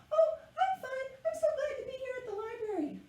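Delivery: very high, tiny creature voice; little caterpillar puppet. Pretend. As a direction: squeak it out as a friendly little puppet.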